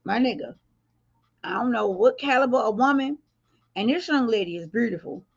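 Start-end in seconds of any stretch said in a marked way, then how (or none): none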